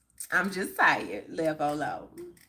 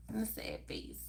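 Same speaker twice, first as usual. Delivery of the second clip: sad whisper; 15 dB quieter than usual. Real, not acted. Whispering a sad remark to chat.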